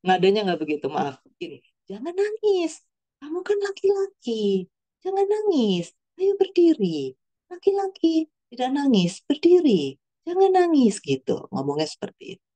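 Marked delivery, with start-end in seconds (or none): 1.9-11.0 imitating a mother firmly coaxing her son, high-pitched; high, measured lines with falling ends, unprovoked; raised-pitch mum voice, steady and spaced out; composed mother's instruction. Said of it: "saya pikir nadanya bagus ya, dia tidak terpancing, dia tetap tenang".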